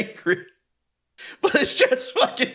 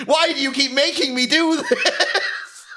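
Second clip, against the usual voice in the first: slow, higher wail through laughter; hammed-up laughing complaint; mock anguish; 5 semitones higher than usual. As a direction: lament slowly while cracking up. Mocks a whining studio, laughing.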